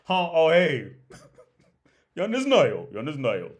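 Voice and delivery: loud, laughing imitation of a startled Russian-Czech speaker, lines ending in falls; mock Russian-Czech. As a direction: caught in the fridge, fumble the Russian-Czech phrases through a laugh. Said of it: "that was Russian mixed with Czech".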